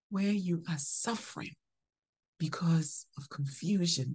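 breathy whispered aside; hushed, unvoiced phrase. Real, not acted. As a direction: drop to a whisper.

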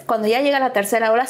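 normal talking voice

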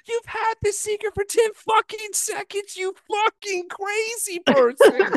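high, exasperated rant; incredulous rant. Real, not acted. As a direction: rant at him in disbelief. Ranting at the character.

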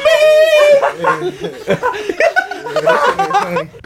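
high-pitched squealing laugh; helpless real laughter. Real, not acted. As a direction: crack up in a long burst. Group cracks up at banter.

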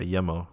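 Yemo with a short "e"; first syllable of Yemo rhymes with "hem"; Yemo as YEM-oh. Shown as a demonstration. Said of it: "How would you say it?".

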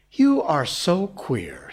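slow, puzzled remark; frankly puzzled. A narrator's reading. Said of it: "frankly puzzled".

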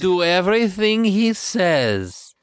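put-on lightly done Eastern European Kermit accent; Kermit's Russian accent. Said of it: "the accent he had as Kermit".

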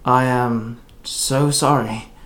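very slow, subdued apology; sighed apology. A narrator's reading. Eli apologises to Indra over the broken box, sighing. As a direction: sigh it out haltingly. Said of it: "Eli sighed".

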